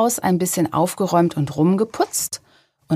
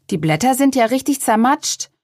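higher-pitched exclamation; exclaiming; 7 semitones higher than usual. A narrator's reading. Boy seeing the squashed strawberry plants, exclaiming. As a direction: exclaim it in a raised voice. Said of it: "rief Luis".

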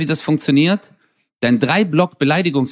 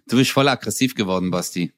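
usual speaking voice

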